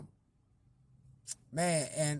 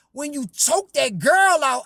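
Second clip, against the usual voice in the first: slow, much louder accusation; mock accusing; 5 semitones higher, 9 dB louder than usual. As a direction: drag it out loud like an accusation. Lady's accusation.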